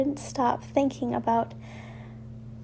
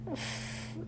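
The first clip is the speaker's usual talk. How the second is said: plain reading voice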